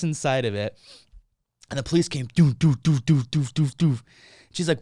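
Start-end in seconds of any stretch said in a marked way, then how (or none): 2.3-4.0 series of short thudding "doof" noises; imitated pounding. Storyteller imitating the police arriving, rhythmic thuds. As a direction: mimic a repeated heavy thud.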